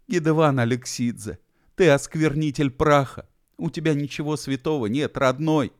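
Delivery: high-pitched, mildly outraged scolding; scandalised reproach. A narrator's reading. Bi accuses Gedevan of desecrating remains, scandalised reproach. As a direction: reproach as if at sacrilege.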